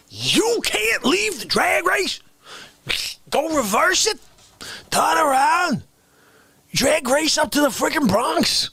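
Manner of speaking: high indignant yelling; outraged shout. Pretend. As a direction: rant in disbelief. Mock outraged rant.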